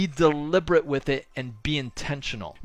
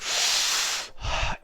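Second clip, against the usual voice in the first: slow, audible intentional exhale; breathing demo; 4 dB quieter than usual. Shown as a demonstration. Showing listeners the breathing exercise, slow exhale. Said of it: "You've heard me do it. It's like".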